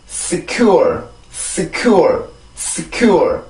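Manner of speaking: secure with a thin hissed S, then stressed falling CURE; English pronunciation. Shown as a demonstration. Teacher drilling learners on the word, careful English pronunciation. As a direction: model secure as s-KYOOR, final r kept. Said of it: "이 단어는 그렇게 발음을 해주면 돼요".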